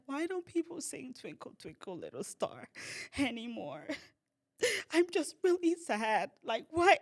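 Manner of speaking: high, weepy mock lament; imitated crying. Upset lady after anaesthetic mourning a nursery rhyme, crying. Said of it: "Why are you crying?".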